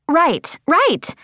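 high, quick agreement; high-pitched, keen young follower; eager pupil. A narrator's reading. Sadie agreeing with her mentor, eager pupil.

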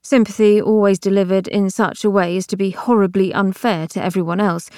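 usual speaking voice

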